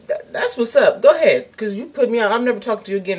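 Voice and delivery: loud, defiant dismissal. Pretend. Telling off a parent who put you out, loud and defiant. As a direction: tell the parent off for good.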